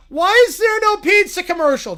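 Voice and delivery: loud, high complaint; demanding tantrum. Acted. Viewer demanding a pizza commercial, high loud tantrum. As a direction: whine it loudly like a thwarted child. Acted fan tantrum during broadcast.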